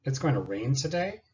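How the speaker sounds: "today" rises, turning a statement into a question; a plain statement that lifts up at the end; rising statement question. Shown as a demonstration. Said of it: "Other questions".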